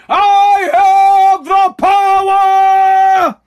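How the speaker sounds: huge high, drawn-out battle cry; He-Man. Retold or imitated. Podcaster imitating a cartoon hero's power cry, roaring shout. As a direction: bellow it loud and stretch every word. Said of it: "like He-Man".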